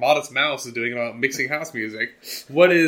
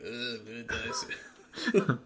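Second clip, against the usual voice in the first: slow, softer mock groaning complaint; dying patient; 8 dB quieter, slower than usual. Joking about the last months on dialysis, mock dying voice. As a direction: play a feeble patient on dialysis. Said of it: "like, on dialysis and".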